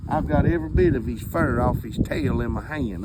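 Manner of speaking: usual speaking voice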